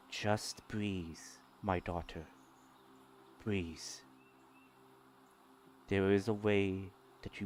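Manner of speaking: very soft, low and soothing; hushed low voice, gently steadying someone in distress; soft calming voice. Acted. Game master as mysterious voice steadying a daughter, soft and calming. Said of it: "hear a soft voice".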